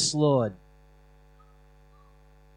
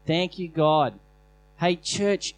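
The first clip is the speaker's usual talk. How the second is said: ordinary talk, nothing marked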